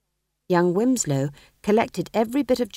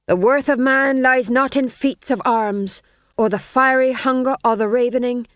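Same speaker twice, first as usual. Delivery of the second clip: dramatic orator; 5 semitones higher, 6 dB louder than usual. A narrator's reading. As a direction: proclaim it like a stage hero. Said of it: "His voice became deeper".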